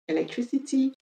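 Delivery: "Electricity" the British way, crisp t in -ty; British English pronunciation, sounding the final t; British accent. Shown as a demonstration.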